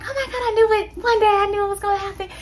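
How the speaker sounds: rapid, high, delighted outburst; excited mom. Retold or imitated. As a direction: imitate mom shrieking with joy. Mom reacts to the news.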